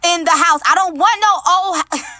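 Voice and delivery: worked-up, high-pitched rant; yelling without realising. Real, not acted. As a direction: get carried away and raise your voice. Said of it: "Am I yelling?".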